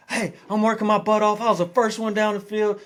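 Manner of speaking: snapping back at the coach in the retelling; heated comeback. Retold or imitated. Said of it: "I'm going back like".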